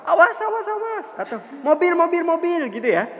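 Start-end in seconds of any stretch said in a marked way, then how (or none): 0.1-1.1 very high, rapid repeated cry; urgent warning. Acted. Passer-by warning Zulfa of a car, acted urgent high cry. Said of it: "orang negur bilang apa". 1.7-2.6 very high repeated alarm call; urgent warning. Acted. Passer-by warning Zulfa of a car, acted urgent high cry. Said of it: "orang negur bilang apa".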